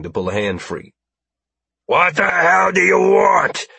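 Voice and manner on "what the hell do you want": high, loud, slow furious yell; shouting. Acted. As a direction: roar it at them, enraged. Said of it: "he shouted".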